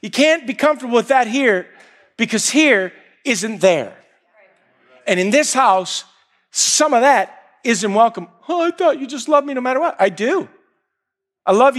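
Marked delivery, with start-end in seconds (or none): 0.0-8.3 firm raised call, louder; mercy laying down the house rule; God's mercy speaking. Retold or imitated. Preacher voicing God's mercy to the son, firmly louder. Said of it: "God's mercy that says". 8.5-9.9 quick, higher plea; hurt son. Retold or imitated. Son pleading with the Father, higher and quick. Son answering God's mercy.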